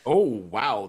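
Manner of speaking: ordinary delivery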